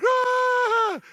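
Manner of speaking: put-on high, loud frightened child's scream. Daughter screams at the Satan figure, high and loud. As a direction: shriek like a scared five-year-old.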